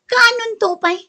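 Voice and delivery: high, loud disbelieving "what, when?"; incredulous. Acted. Wife mocking her husband's washing machine promise, incredulous. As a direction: scoff in disbelief at his empty promise.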